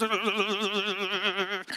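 juddering engine vibration noise; low, fluttering imitation of a shaking car; car shudder. Driver mimicking his car at seventy, juddering shudder. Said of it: "it shook".

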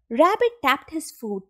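ordinary delivery, nothing marked

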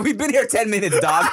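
laughing through the words. Retold or imitated. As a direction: chuckle while teasing. Laughing at friend's red face.

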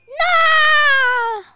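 long, high, loud "no"; falling scream. Acted. Character falling from the castle, high drawn-out yell. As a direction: cry out as if plummeting.

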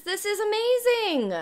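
high, rising-then-falling squeal of delight; excited squeal. Real, not acted. Streamer overwhelmed by the new pack, excited squeal.

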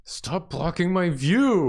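high playful whine. Pretend. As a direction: whine it like a small creature. Playful bickering over the view.

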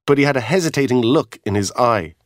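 ordinary delivery, nothing marked

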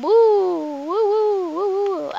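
playful high woo-woo cheer; sing-song hooting to celebrate the room; excited woo. Real, not acted.

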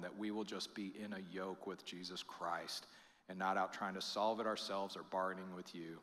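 soft, hushed, slowing prayer; pastoral prayer. Acted. Pastor closing the sermon, a soft hushed prayer. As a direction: pray quietly over the congregation.

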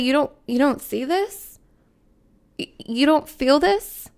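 acted disbelief, pitch lifting sharply; mock disbelief. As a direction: ask it as if baffled others can't. Acting an attuned person's disbelief.

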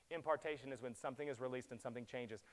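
ordinary talk

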